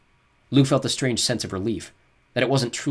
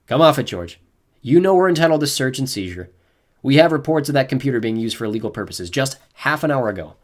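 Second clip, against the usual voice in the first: slightly doubtful; 4 semitones higher, 7 dB louder than usual. A narrator's reading. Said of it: "slightest edge of doubt".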